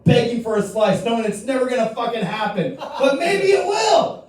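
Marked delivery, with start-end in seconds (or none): 2.8-4.2 the dog's big hope, yelled slowly; drawn-out shout. Acted. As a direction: stretch it out and yell it. Hyped punchline about the dog.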